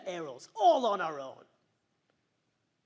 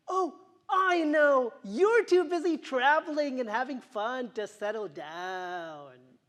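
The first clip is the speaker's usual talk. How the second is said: condescending; 5 semitones higher, 8 dB louder than usual. Retold or imitated. Condescending friend quoted.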